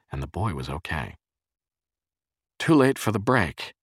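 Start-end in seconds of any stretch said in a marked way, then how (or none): 2.6-3.7 mild breathless urgency, higher and louder; panting. A narrator's reading. Jerry after running to save the boy, panting. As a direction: call it out as if winded. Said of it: "Jerry panted".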